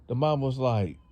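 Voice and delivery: ordinary delivery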